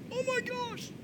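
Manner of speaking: shooting way up, soft and breathless; mock stunned gasp at a great quote; gasped amazement. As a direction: squeal of delight, very high but quiet. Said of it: "I'm like".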